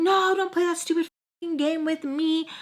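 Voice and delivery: high, heated imitated retort; retold irritated snap back; angry confrontation. Retold confrontation after the fight.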